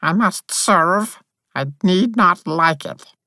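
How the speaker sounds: each word a reluctant vow; dark tone. Acted. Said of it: "in a dark tone".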